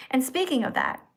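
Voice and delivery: usual speaking voice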